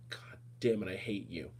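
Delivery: low, very quiet retold curse; quietly cursing them under retold anger; retold muted anger. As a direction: drop low and soft, recalling the anger. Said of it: "I was so angry at you guys".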